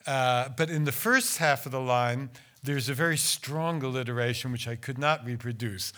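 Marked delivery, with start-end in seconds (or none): none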